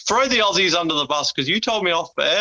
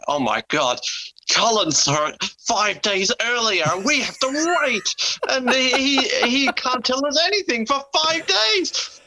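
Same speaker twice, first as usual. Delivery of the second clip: high, slow whimpering complaint; hammed-up teary moaning about waiting; mock whining; 9 semitones higher than usual. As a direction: whine like a left-out fan. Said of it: "it was like".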